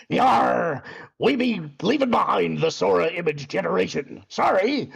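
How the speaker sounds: pirate voice with a shouted opening yar; pirate accent. Acted. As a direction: play a jokey pirate captain. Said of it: "speaking in a pirate accent".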